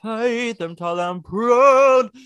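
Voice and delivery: high, loud, slow mock belting. Podcaster belting a line from the song. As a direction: belt it out like a showstopper. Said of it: "quoting the song".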